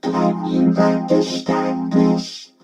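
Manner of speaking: slow, firm robotic synth speech; vocoder voice. Shown as a demonstration. Reviewer testing vocoder clarity, slow robotic voice. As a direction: draw it out through a vocoder. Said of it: "Softer, that one".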